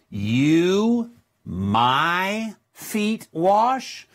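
each word drawn out very slowly, Greek word order; slow word-by-word reading. Shown as a demonstration. Preacher showing the congregation Greek word order, very slow. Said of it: "I'm gonna change this just slightly".

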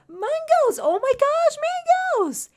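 high, loud delighted squeal; excited squeal. Real, not acted. Streamer to subscriber Mangoes, excited squeal. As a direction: squeal it in thrilled surprise.

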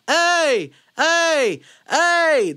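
high, loud "hey" on little air; yell without breath. Shown as a demonstration. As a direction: try yelling with empty lungs. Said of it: "haven't taken a breath and you try to yell".

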